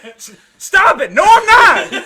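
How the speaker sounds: high, loud, stammering denial; flustered protest. Retold or imitated. The teased roommate protests loudly at the taunting. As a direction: yelp it out, rattled and protesting. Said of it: "And I'm like".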